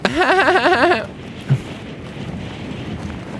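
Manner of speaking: long high-pitched laugh. Real, not acted. Laughs at a funny answer.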